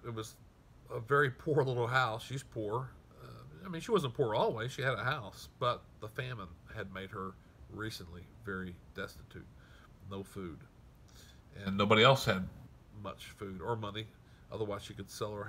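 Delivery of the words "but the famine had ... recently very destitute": ordinary delivery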